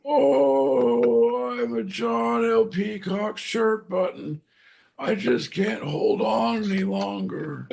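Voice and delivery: high weary little button; tiny button voice. Pretend. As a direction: whine it as a tired button. Voicing shirt button character.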